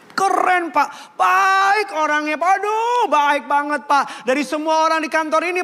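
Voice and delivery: high, slow, gushing coworker; an over-the-top fan of Pak Tono, high and drawn out; gushing praise. Retold or imitated. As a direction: lay the praise on thick and sweet. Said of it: "Atau jawabnya begini".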